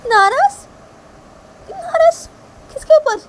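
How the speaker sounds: very high, loud, disbelieving questions; piercingly high confused character voice; bewildered Shanaya. Acted.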